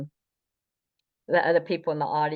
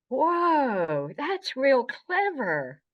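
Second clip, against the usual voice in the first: high, drawn-out impressed reaction; mock-amazed, slow and high-pitched; imitated amazement; 9 semitones higher, much slower than usual. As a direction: stretch it out high, as if slowly won over. Acted reaction after thinking it over.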